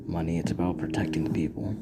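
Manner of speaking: low, soft inner monologue; thinking to himself. Acted. Midoriya privately disagreeing about what heroes are, quiet inner thought. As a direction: say it privately, trailing into near whisper. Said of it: "Midori just thinks to himself".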